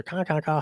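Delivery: high mock raven cawing; raven caws. Mimicking ravens harassing the owl, high cawing. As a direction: caw like a raven.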